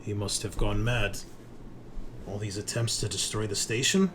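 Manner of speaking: usual speaking voice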